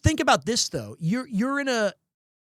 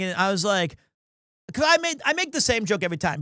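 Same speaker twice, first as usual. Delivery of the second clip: usual speaking voice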